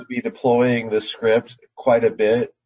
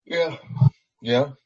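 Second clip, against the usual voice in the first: yawning; slower than usual. Real, not acted. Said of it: "Sorry, I'm, I'm yawning".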